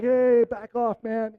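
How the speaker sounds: cocky brush-off. Retold or imitated. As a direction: wave the lifeguard off with bravado. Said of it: "And I was like".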